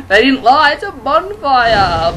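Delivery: loud, high, drawn-out celebration; jubilant cheer. Real, not acted. Gamer finally reaching the bonfire, jubilant cheer. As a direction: stretch it out in triumph.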